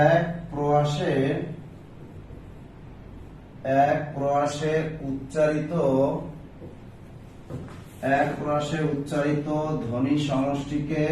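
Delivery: slow, measured words for note-taking; slow dictation. Real, not acted. Teacher writing out the definition for students, slow dictation. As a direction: dictate it slowly, piece by piece.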